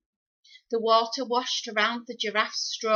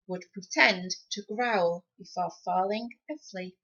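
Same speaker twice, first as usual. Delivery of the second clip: ordinary delivery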